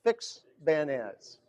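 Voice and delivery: fix bayonets, each word slow and weighted; slow emphatic. Shown as a demonstration. Preacher leads congregation to repeat the phrase, slow and emphatic. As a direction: lay down fix bayonets slowly, like a vow. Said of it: "maybe we just say that".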